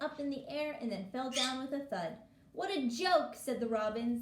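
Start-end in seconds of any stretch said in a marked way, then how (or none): 2.5-3.4 mocking. Robins mocking Toad's kite.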